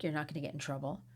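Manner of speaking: normal talking voice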